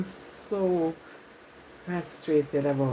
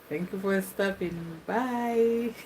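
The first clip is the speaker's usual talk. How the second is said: slow farewell; 4 dB quieter, slower than usual. Acted.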